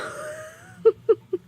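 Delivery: high squealing laugh; high burst of laughter. Real, not acted.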